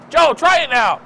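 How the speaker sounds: high, loud call to a coworker; calling out loudly. Acted. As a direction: shout the line across the room.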